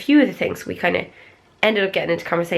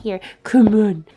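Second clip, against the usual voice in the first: higher, sing-song coaxing voice for the dog; pet-talk voice; 5 semitones higher than usual. Real, not acted. To her dog Bilby, higher sing-song pet talk.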